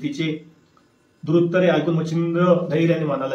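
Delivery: ordinary delivery, nothing marked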